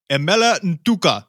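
Emela Ntuka pronounced boldly like a Lingala speaker, each syllable rolled out; native-style pronunciation. Shown as a demonstration. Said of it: "That was pretty good, man".

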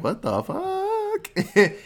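squeaky high, stretched-out disbelief; hammed-up stunned villain; drawn-out high mock outrage; mock shock.

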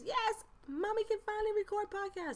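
very high-pitched, squealed celebration; acted-out giddy mommy cheer; excited announcement. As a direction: squeal the good news to the kids. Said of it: "so excited to be here".

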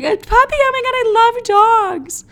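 very high squealing delight, slowed; mock squeal. Girl gushing over a guy's puppy photo, high squeal. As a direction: gush like a smitten girl.

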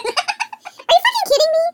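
a giggling, incredulous outburst; laughing disbelief. Real, not acted. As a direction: squeal it out while laughing.

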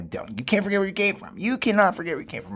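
lightly voiced mom, lecturing; imitating mother. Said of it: "She tells me".

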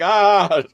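high, loud mock scream; hammed-up dying yell; imitated death scream. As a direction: act out a doomed character's cry. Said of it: "screaming and dying".

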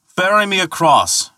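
high-pitched carrying call. Acted. Thor demanding passage from the ferryman, calling out. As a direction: call it over the water. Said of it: "called Thor".

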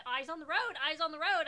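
mock a nervous kid demanding focus; nervous back-seat driver. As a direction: kids nagging the driver on a mountain road.

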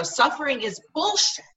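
heated, punchy retelling of a yell; retold angry yell. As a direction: re-enact yelling at God. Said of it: "I yelled that".

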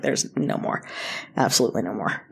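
low, quiet and final; firm refusal. Retold or imitated. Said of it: "I was like".

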